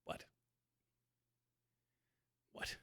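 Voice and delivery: usual speaking voice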